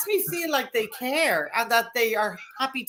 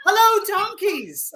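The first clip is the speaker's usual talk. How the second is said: loud, high, slow holler; drawn-out, raised hailing call; shouted greeting; 8 semitones higher, 6 dB louder, much slower than usual. Shown as a demonstration. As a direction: call out slowly to the donkeys. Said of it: "what noise do you shout to the donkey?".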